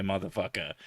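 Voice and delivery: usual speaking voice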